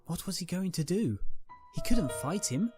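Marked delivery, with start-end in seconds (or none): none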